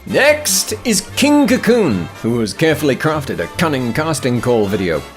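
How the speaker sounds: normal talking voice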